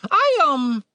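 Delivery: high bird voice, faltering; unsure little bird; hesitant talking bird. A narrator's reading.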